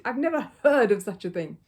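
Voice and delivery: loud outraged bellow; indignant. A narrator's reading. Neighbour outraged at the missing page, loud. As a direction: blast out his disbelief. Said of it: "he bellowed".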